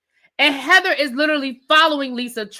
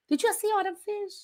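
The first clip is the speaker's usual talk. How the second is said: high, soft, hushed question; hushed aside; 6 semitones higher, 9 dB quieter than usual. Pretend. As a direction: ask it small and high, as if confiding.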